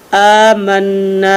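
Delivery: आमन्ना with the न्न held and slowed; doubled nun drawn out. Shown as a demonstration. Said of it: "नून ज़बर अलिफ ना".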